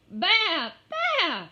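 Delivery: very high, loud bleats; lamb bleat. Acted. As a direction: bleat twice, shrill and sharp. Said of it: "it bleated".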